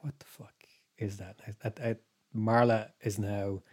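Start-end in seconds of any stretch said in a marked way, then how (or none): 0.0-1.4 hushed, frightened whisper; whispered what-the-fuck at the noise; scared whisper. Retold or imitated. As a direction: breathe it out alarmed in the dark.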